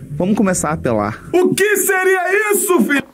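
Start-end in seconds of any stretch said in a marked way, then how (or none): 1.3-3.0 mock shock.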